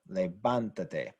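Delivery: levantate with the stress on "van"; stress on third-last syllable. Shown as a demonstration. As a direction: lean on the "van" in levantate, then let "tate" fall away. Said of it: "we need to show that it's stressed".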